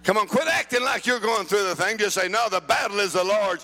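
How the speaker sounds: rhythmic, building revival sermon; driving preaching. Real, not acted. Preacher tells congregation what the blood has done, driving rhythmic preaching.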